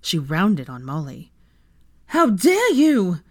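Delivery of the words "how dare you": slow, loud, high-pitched outrage; indignant, drawn-out shout; angry growl. A narrator's reading. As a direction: round on them furiously, stretching the words. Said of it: "she growled at the woman".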